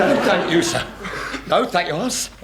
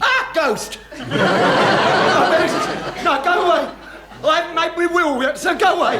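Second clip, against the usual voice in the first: mock panic; 9 semitones higher than usual. Chief panics at ghost guess.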